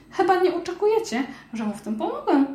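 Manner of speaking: high, amazed mouse voice; a tiny mouse who can't believe it's real; little mouse voice. Acted.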